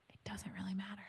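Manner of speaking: unvoiced whispered aside; a whispered punchline. Real, not acted. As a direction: drop to a whisper to land it.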